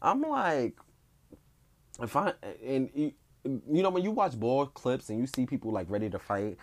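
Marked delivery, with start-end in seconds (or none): none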